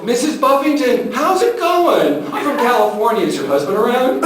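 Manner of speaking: hammed-up chirpy hello to a supposed wife; over-friendly caller. Caller mistaking a man for Missus Buffington, over-friendly.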